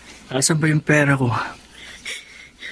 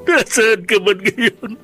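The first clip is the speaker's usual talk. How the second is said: desperate pleading; 11 semitones higher than usual. Acted. Son begging his lost mother's forgiveness, sobbing. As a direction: beg through tears, breaking.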